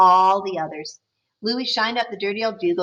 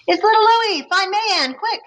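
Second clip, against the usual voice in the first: high, loud excited calling out; 11 semitones higher, 3 dB louder than usual. Acted. Neighbor spots Louis marching and calls out, loud and excited. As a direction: call out the news with a burst of joy.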